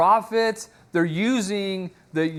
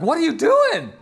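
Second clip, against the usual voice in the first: high, louder panicked outburst; acted panic; 12 semitones higher, 5 dB louder than usual. Medium seeing Samuel appear, acted panic. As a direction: imitate a startled woman in a panic. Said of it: "see the panic in her voice".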